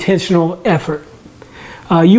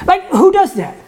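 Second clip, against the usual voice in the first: incredulous; 10 semitones higher than usual. Real, not acted. Rhetorical incredulity at the congregation.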